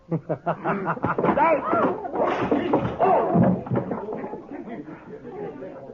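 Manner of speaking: a long, high mocking laugh; gloating laugh. Webb taunting the marshal that nothing can be proved, mocking laugh. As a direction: laugh in his face, openly gloating.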